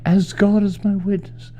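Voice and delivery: high Southern belle voice, dramatic vow; high-pitched hammed-up Scarlett vow; Vivian Leigh impression. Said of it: "give us your Vivian Leigh".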